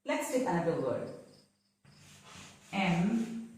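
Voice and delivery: ordinary talk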